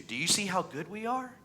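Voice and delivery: high, smug, self-righteous boasting; mocking Pharisee. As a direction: puff yourself up like a proud Pharisee. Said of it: "we live like the Pharisees do".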